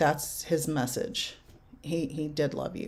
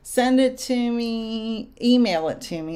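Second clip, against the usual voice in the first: drawn-out, higher and louder, leaning on it; emphatic request; 6 semitones higher, 6 dB louder than usual. Real, not acted. Medium to viewer, wanting the video: emphatic slow call. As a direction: call it out slowly so the viewer acts.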